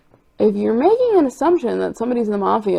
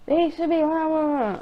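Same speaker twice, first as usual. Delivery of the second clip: much higher mock rant; angry Bankman; 9 semitones higher than usual. Bankman arguing with Ray, much higher mock rant. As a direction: squeak out a petty rant. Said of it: "because he was like".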